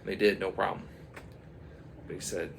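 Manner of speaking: ordinary delivery, nothing marked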